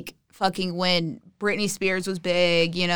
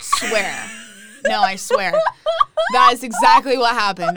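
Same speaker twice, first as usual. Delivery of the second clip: laughing; 2 semitones higher, 5 dB louder than usual. Real, not acted. Laughing insistence.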